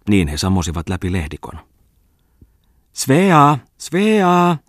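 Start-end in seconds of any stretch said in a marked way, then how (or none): 2.9-3.6 loud, much higher call from afar; lady calling the girl from behind the alders. A narrator's reading. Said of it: "kaikui rouvan ääni lepikon takaa". 3.8-4.6 second call pitched even higher; lady calling the girl again, louder and higher. A narrator's reading. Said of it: "kaikui rouvan ääni lepikon takaa".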